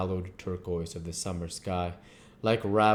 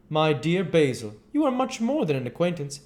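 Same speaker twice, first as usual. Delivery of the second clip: Lord Henry the dandy; 10 semitones higher, 6 dB louder than usual. Acted.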